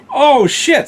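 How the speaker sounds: high, loud sharp curse; alarmed curse. Retold or imitated. Searching the house for the fire, loud alarmed curse. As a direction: blurt it out in alarm.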